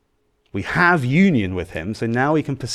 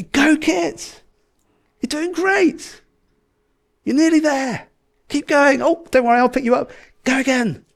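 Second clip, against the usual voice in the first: high, bright encouraging cheer; 3 dB louder than usual. Pretend. Dad cheering his little boy up the stairs, excited cheer. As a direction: cheer the toddler up every step. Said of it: "I'm cheering him on".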